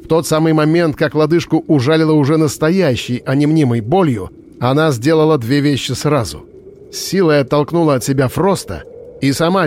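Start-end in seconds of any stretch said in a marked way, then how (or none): none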